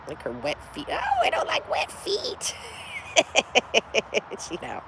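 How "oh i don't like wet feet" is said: very high, soft, slow kitty voice; voiced cat. Speaking for the cat with wet feet, tiny high pet voice. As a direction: squeak a drawn-out little complaint as the cat.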